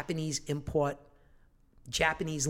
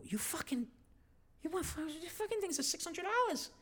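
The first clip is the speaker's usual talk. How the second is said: high, softer, disbelieving voice; incredulous; 10 semitones higher, 10 dB quieter than usual. Retold or imitated. Husband aghast to wife over six-hundred-dollar sneakers, high. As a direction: squeak out shocked disbelief at the price. Shocked at kid's price.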